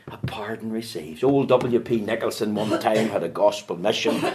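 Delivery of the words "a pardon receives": soft unvoiced whisper. Preacher quoting a hymn to the hall, whispered. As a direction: breathe the words out.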